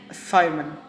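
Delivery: ordinary delivery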